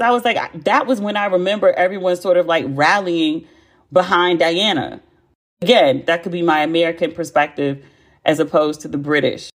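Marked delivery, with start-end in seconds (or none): none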